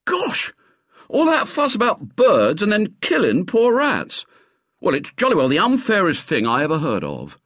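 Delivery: high-pitched, outraged schoolboy; appalled boyish outburst; incredulous horror. A narrator's reading. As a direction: protest in shocked disbelief, pitched right up. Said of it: "gave a gasp of incredulous horror".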